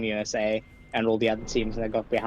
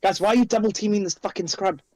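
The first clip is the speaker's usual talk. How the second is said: annoyed shout; 7 semitones higher than usual. Real, not acted. Yelling at players in game.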